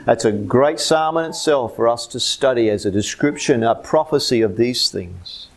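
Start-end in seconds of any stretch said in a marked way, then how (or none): none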